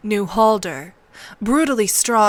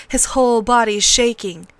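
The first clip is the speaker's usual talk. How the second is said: ordinary delivery, nothing marked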